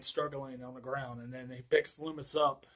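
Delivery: ordinary talk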